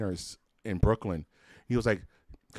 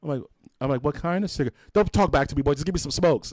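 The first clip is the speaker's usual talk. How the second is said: mock grandpa bark; 5 semitones higher, 4 dB louder than usual. Grandpa bossing kid in story.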